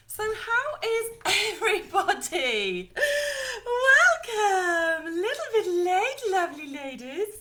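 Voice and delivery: high, loud gushing welcome with a laugh; squealing greeting to the lovely ladies; excited. Real, not acted. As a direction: burst in with a big excited hello. Bursting into launch welcome.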